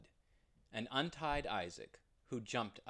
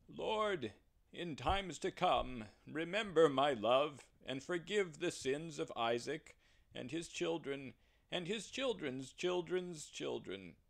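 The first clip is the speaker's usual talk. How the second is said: higher, earnest plea; Abraham's prayer; 4 semitones higher than usual. Acted. Abraham asks the Lord to forgive, higher and earnest. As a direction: ask with devotion. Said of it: "And Abraham said".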